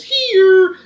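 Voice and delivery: high, loud, drawn-out call. A narrator's reading. Invisible child answering mummy, calling out. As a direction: sing out slowly from hiding. Child calls back to mother.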